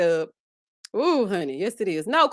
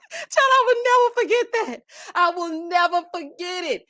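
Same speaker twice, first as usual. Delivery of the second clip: laughing; 7 semitones higher than usual. Real, not acted. Still laughing at the memory.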